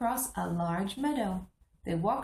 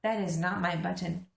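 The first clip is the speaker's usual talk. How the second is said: plain reading voice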